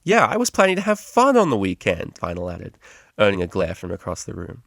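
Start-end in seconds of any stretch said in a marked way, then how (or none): 0.0-2.1 high, loud, whiny protest; sarcastic complaint. Acted. Vinyl complains to the tutor, high and whiny. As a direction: moan about losing the weekend.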